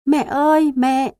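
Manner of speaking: high, loud cry of mom; child's scream. A narrator's reading. Startled boy screams for his mother during the storm, loud. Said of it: "Thằng bé giật mình lại hét lên".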